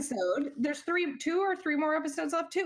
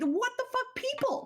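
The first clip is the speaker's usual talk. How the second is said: incredulous; 11 semitones higher than usual. Real, not acted. Ranting about the scene.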